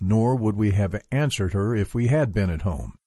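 ordinary delivery

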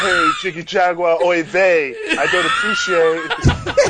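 put-on Yiddish accent, caricature of a complaining caller.

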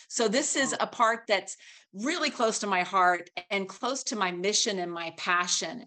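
ordinary talk, nothing marked

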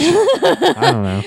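put-on high, slow old-timer drawl; creaky old prospector voice. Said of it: "I didn't even do my hat".

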